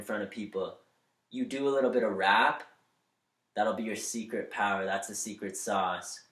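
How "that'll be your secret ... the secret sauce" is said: normal talking voice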